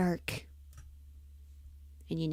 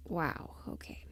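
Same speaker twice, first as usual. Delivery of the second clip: hushed wow; 2 semitones lower, 8 dB quieter than usual. Real, not acted. Surprised at what she found.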